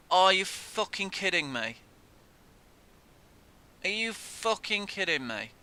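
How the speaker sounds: real high, loud outraged cry; furious disbelief. Real, not acted. Gamer raging at the screen after a goal, loud disbelief.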